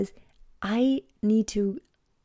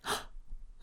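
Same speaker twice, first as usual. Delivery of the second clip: a quick, breathy gasp of sudden remembering; sharp gasp; 15 dB quieter than usual. Retold or imitated. Daughter suddenly remembering at bedtime, a sharp gasp. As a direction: gasp like you just remembered something awful.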